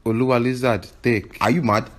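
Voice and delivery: ordinary delivery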